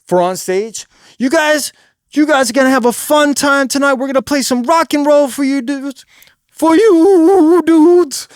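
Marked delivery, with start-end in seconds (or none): 1.2-6.0 higher, projected frontman patter; amped-up stage voice. Shown as a demonstration. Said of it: "twenty percent more than that for on stage". 6.5-8.3 loud, high, wavering long vowel; drawn-out stage holler. Shown as a demonstration. Stage demo peaks in drawn-out cry.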